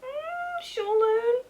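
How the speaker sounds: very high, slow, dreamy swoon; hammed-up high romantic swoon; mock swoon. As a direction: sigh it out like a lovestruck girl.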